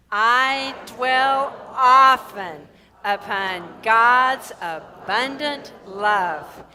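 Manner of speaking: slow, loud, drawn-out proclamation; group affirmation. Shown as a demonstration. Minister leads the third affirmation, slow and loud. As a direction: stretch each word out for the whole room. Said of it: "let's align with it the third time".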